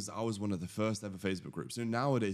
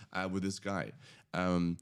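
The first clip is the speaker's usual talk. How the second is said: usual speaking voice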